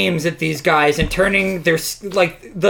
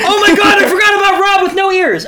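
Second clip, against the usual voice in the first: much higher, louder, fast fangirl cry; mock fan squeal; 5 dB louder, faster than usual. Mocking a League fan, squealing fast. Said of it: "if you're like".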